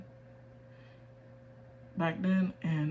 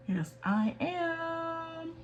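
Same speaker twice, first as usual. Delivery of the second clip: much higher, slow, playful squeak; squeaky high; 11 semitones higher, much slower than usual. Pretend. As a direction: squeak it out cutely. Playful self-answer, repeated.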